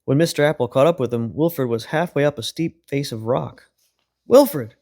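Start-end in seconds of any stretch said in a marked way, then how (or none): 4.3-4.8 high, louder call of alarm; cry out. A narrator's reading. Mister Apple calls Wilfred off the cliff, loud. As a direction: call him down from the rock. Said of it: "cried Mister Apple".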